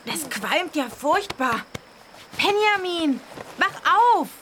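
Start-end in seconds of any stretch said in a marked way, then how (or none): 2.4-4.3 calling out. Acted. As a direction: call him awake. Friend shouting to wake the sleeper.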